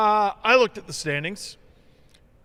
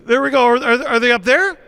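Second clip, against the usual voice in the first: mock searching; 6 semitones higher, 6 dB louder than usual. Taunting search for rival fans.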